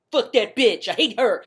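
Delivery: mock angry foreman. Foreman cursing the woman who turned him down, mock angry. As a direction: play the spurned foreman lightly hammed-up.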